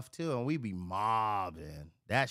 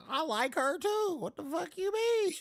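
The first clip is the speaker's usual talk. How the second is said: high-pitched, incredulous outburst; indignant protest; 3 dB louder than usual. Retold or imitated. Man answering friends asking about the girl, indignant. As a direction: protest in a squeaky, worked-up voice. Acting out his indignant reply.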